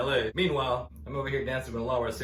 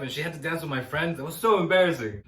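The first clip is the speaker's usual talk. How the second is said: ordinary talk, nothing marked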